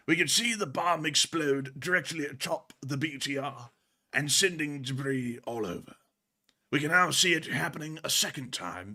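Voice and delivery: soft, hushed wildlife narrator; nature documentary. Acted. Narrates clip like nature film.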